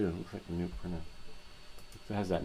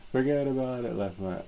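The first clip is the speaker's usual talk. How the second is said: higher, louder mock New Yorker; New York accent; 6 semitones higher, 6 dB louder than usual. Greeting a viewer in a hammed-up New York accent. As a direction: belt it like a Brooklyn guy. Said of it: "I heard that in a New York accent".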